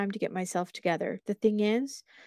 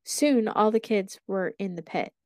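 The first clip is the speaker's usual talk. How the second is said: usual speaking voice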